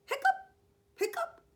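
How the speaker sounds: high, loud squeaky hiccup sounds. Acted. Reading a story to kindergarteners, high loud hiccups. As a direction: hiccup it out like a big dog.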